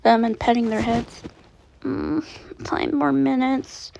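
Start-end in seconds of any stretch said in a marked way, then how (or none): 1.8-3.9 drowsy, slow, dragged-out mumble; sleepy mumble. A narrator's reading. Child too sleepy to get up, slow drowsy mumble. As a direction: mumble it half asleep, slowly. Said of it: "Chi-Chi mumbles".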